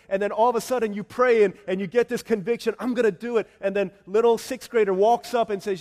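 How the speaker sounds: loud, climbing preacher's cry; full-force preaching. Acted. Pastor pleading with the congregation, loud preaching. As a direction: preach it out over the music.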